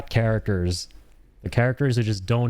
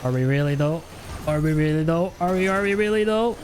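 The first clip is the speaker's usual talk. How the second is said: rising higher each time, mock-questioning; teasing doubt; 8 semitones higher than usual. Real, not acted. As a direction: needle yourself, climbing higher. Doubting own "we're cooked.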